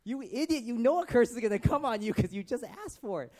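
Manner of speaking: loud, high exasperated scolding; mock outrage. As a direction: berate him in hammed-up disbelief. Berating Peter for cursing himself.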